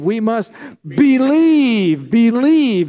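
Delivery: loud, drawn-out believe; rousing congregation shout of belief; shouted response. Real, not acted. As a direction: call it out to the whole room. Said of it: "scream it at the top of your lungs".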